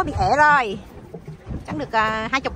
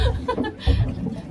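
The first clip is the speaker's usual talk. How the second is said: high burst of laughter; 11 semitones higher than usual. Real, not acted.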